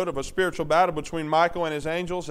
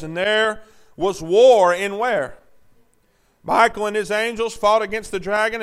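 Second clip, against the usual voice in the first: loud, raised scripture reading; full-force preaching; 6 semitones higher, 10 dB louder than usual. Acted. Preacher reading Revelation to the congregation, loud and forceful. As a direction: drive each verse home loudly.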